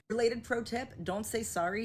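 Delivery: usual speaking voice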